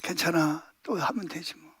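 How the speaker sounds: put-on softly telling the child it's fine to try again; gentle reassurance. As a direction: soothe a child after a zero score.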